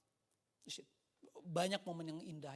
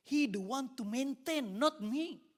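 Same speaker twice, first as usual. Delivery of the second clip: loud, high, slow preacher's answer; emphatic declaration; 8 semitones higher, 10 dB louder, slower than usual. Retold or imitated. Evangelist corrects the interviewer, loud and emphatic. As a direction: declare it slowly with raised voice.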